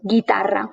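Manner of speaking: guitarra with hard G, silent U and rolled R; Spanish guitarra with no U sound. Shown as a demonstration. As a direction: say guitarra as "gee-TA-rra". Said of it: "the G is a hard G".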